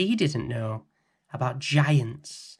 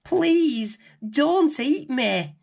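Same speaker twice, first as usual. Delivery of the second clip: put-on high, slow begging girl; frightened plea; 4 dB louder, much slower than usual. A narrator's reading. Girl begs the giant not to eat her, frightened. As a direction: plead for your life, a little scared.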